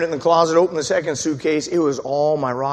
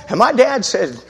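ordinary delivery

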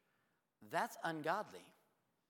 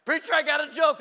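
much higher and much louder, bursting in; eager old lady; 20 dB louder than usual. Retold or imitated. Ann announcing her joke to the pastor, loud eager voice. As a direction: call out like a keen church lady with a joke. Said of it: "She's like".

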